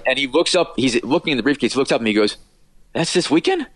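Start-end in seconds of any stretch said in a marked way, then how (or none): 2.9-3.7 high-pitched puzzled question; surprised realisation. Retold or imitated. Imitating Chet looking up from his briefcase, surprised. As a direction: lift the pitch as if only now realising. Chet forgot the wedding date.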